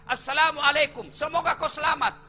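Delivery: preacher calling out the blessings; fervent salam. Shown as a demonstration. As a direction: proclaim each blessing with force. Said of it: "Tiga isi dalam doa".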